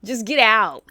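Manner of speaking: loud, firm order to leave; dead serious, loud get out; angry get out. Real, not acted. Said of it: "I'm dead serious".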